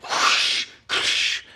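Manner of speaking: unvoiced whoosh, like cans flying; breathy whoosh noise. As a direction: blow out two quick whooshes. Imitating beer cans being thrown.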